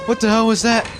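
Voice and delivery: loud, high startled yell; startled shout. Real, not acted. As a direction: jump out of your skin. Sudden scare in horror game.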